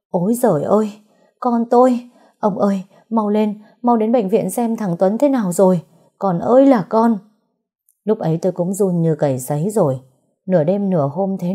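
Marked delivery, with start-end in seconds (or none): 0.1-7.2 wailing. Acted. Mother-in-law wails on hearing her son was stabbed, wailing. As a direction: wail in despair, calling out. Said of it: "sau đó gào ầm lên".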